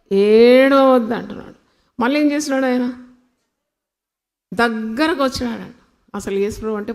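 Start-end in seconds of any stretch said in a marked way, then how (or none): 0.1-1.1 loud, slow, drawn-out plea; Jesus' consoling command. Acted. Preacher voicing Jesus to a weeping widow, loud and drawn out. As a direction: stretch it out and project it firmly. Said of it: "ఏం పలుకుతున్నాడు".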